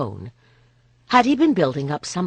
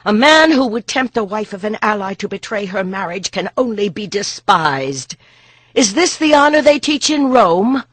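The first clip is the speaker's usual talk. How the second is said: loud, higher contempt, slowing on despised; scornful fury; 7 semitones higher, 9 dB louder than usual. Acted. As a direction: spit out the insult. Boudica scorns the Roman.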